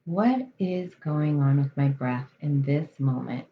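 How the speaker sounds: calm, measured meditation voice with long pauses; guided meditation. Real, not acted. Coach leading listeners through breath awareness, guided meditation. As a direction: settle them into the breath, unhurried and even.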